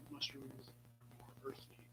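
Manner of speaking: slow soft whisper. Real, not acted. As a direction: breathe it out slowly. Hushed savoring comment.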